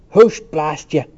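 loud shooing curse; angry cry. A narrator's reading. Cranley chases Temple away, with an angry cry. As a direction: shoo him off with an oath. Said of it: "Cranley cried".

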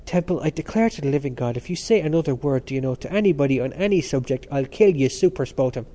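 plain reading voice

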